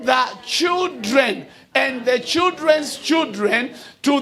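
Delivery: rising, slow, ringing preacher's cadence; stretched, declaiming sermon voice; full preaching. Acted. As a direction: preach it to the congregation with weight. Preaches from the pulpit.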